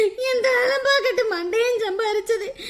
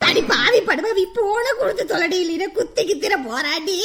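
ordinary talk, nothing marked

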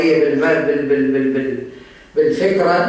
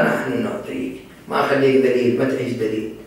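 much softer and lower, kindly promising help; gentle reassurance; 6 semitones lower, 5 dB quieter than usual. Acted. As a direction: speak low and soft, like offering a hand to an enemy. Imam voiced reassuring his enemy.

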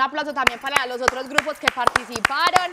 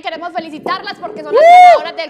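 high, loud congratulating host; hyped giveaway announcement; 7 semitones higher, 10 dB louder than usual. Real, not acted. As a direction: burst with congratulations. Host congratulating the winner on call.

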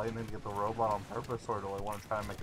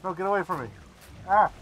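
higher, louder frantic plea; panicked yell; 8 semitones higher, 8 dB louder, faster than usual. Real, not acted. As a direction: yell it at the attacker. Chased by enemy in game.

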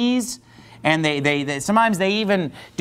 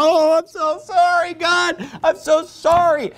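mock wailing apology; 7 semitones higher than usual. Preacher imitates penitents beating their heads on the ground, high mock wail. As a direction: imitate someone wailing and begging for forgiveness. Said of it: "people just grovel and wail and whine".

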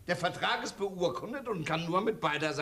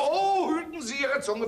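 indignant rebuke; 7 semitones higher, 3 dB louder than usual. Acted. Notary rebukes insulting woman.

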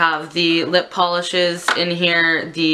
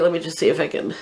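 ordinary talk, nothing marked

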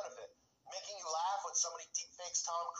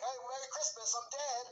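sarcastic mock greeting; 7 semitones higher than usual. Mocking the AI Christmas card.

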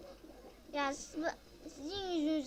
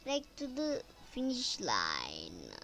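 put-on slow, lower English said with a Turkish accent; 8 semitones lower, much slower than usual. Gamer mocking own English, lower, slow, Turkish accent. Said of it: "Yani İngilizcem çok güzeldir".

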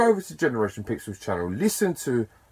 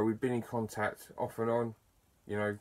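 ordinary delivery

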